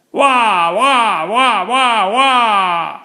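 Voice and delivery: put-on loud high baby wail; baby yelling. A narrator's reading. Baby yelling with everyone standing around it. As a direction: scream like a furious infant. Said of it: "the baby was yelling as loud as possible".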